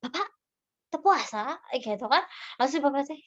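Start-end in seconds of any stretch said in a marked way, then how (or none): 0.0-1.6 higher, pointed question with a rising end; blunt questioning. Shown as a demonstration. Stall keeper questioning an older man about fasting, blunt rising challenge. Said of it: "jangan kayak".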